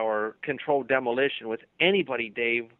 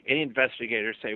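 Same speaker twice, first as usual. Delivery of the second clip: ordinary talk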